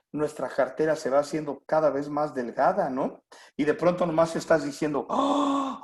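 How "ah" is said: mock shock. Shock at empty wallet.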